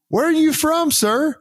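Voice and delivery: very high-pitched mock question; put-on high voice asking a superior; imitated curious junior. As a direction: pipe up high as a curious corporal. Acts out corporal's curious question.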